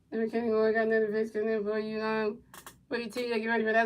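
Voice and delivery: soft running commentary; play-by-play call. Acted. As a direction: murmur the pitches like a broadcaster.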